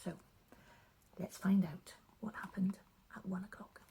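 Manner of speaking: soft, hushed bedtime introduction; hushed story intro. Acted. Storyteller to children, hushed and soft. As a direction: ease listeners in gently.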